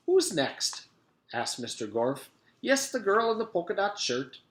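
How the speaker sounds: a lightly voiced kindly teacher; sweet teacher. A narrator's reading. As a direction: coax the class gently. Mister Gorf voice.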